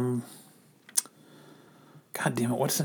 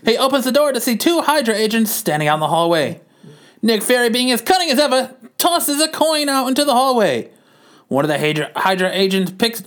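raised, dramatic newsreel read; old-timey narrator; 9 semitones higher than usual. A narrator's reading. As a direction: keep the old newsreel announcer going. Narrates the comic.

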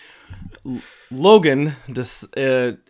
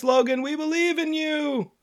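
mock cheering. Friends at the bottom mildly cheering on a stuck camper. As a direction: cheer her on sweetly. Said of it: "shouting encouragement".

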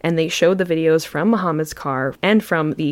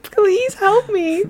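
very high, drawn-out begging; mock pleading; much slower than usual. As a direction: wail it in a hammed-up imitation. Mimics the played phone call pleading.

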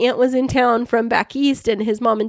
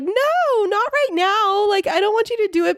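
much higher pouty whine, imitating herself; pouting; 12 semitones higher than usual. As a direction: whine it like a sulking kid refusing. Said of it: "because I was pouting".

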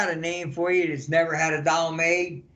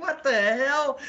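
mock baffled; 7 semitones higher, slower than usual. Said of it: "and they're all like".

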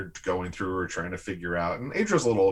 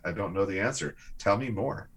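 ordinary delivery

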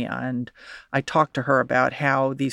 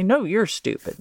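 "No" jumps higher, then "you're stupid." falls away, a mock retort; childish comeback; 6 semitones higher than usual. Bullied girl throws the insult back, higher mock retort. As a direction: mimic a kid firing the insult straight back. Retold schoolyard insult exchange.

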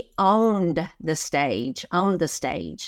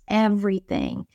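usual speaking voice

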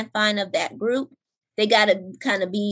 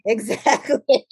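slower laughing agreement; drawn out with a laugh; 4 dB louder, slower than usual. Real, not acted.

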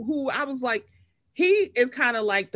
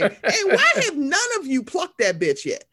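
laughing mock rant.

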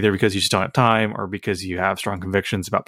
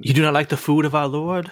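higher put-on sanctimonious voice; mock pious legalist; 5 semitones higher than usual. Legalist challenging someone who dislikes bread, mock affronted piety. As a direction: play an affronted religious stickler.

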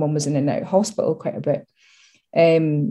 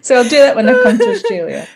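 laughing; 6 semitones higher, 7 dB louder, faster than usual. Real, not acted. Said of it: "laughing about own accent".

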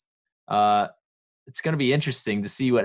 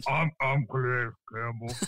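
softer, a hint deeper put-on voice; deeper Calais impression; 5 dB quieter than usual. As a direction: push the mock deep voice further. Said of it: "Can I get any deeper?".

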